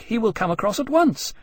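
high, urgent, falling on "once"; shouted warning. Acted. Jane shouting that Bonaparte will come, urgent. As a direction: warn them heatedly, running straight through "at once". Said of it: "Jane was shouting".

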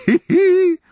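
high hee-hee giggle; gleeful cackle. A narrator's reading. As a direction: mad old man's rising titter. Mad sergeant giggles with glee.

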